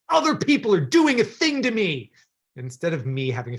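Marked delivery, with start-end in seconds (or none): 0.1-2.1 loud, high mock outrage; hammed-up indignant complaint; blaming American character. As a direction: blame the world in a raised voice. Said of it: "an American story where the person's like".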